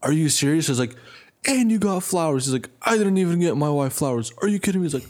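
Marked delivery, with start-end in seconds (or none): 0.0-0.7 high-pitched, genuinely amazed question; joyful disbelief. Retold or imitated. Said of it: "He was, like, genuinely like". 1.5-2.5 very high, overjoyed imitation; delighted amazement. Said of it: "I never seen such joy in my life". 2.8-4.9 high voice of delighted astonishment; overjoyed disbelief. Retold or imitated. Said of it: "I never seen such joy in my life".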